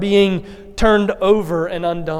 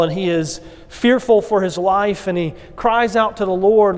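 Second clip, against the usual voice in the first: ordinary talk, nothing marked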